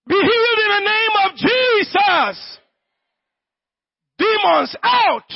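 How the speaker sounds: high, full-force deliverance cry; commanding shout. Real, not acted. Preacher casting out demons and commanding healing, high commanding shout. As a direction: order it out with total authority.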